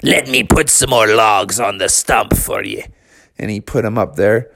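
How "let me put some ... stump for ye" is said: growly storybook pirate; pirate dad. Acted. Pirate dad sets logs for his son, pirate voice. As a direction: offer help as an old sea dog. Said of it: "the pirate dad said".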